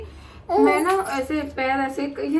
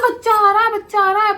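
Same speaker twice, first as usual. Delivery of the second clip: higher and louder alarmed call; panicked shout of labour; urgent cry; 7 semitones higher, 7 dB louder, faster than usual. Retold or imitated. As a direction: shout for help as the baby comes. Crying out in the washroom.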